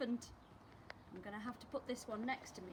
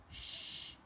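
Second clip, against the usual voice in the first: whispered shush; baby's shush. Acted. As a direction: hush everyone like a tiny baby. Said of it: "said the baby".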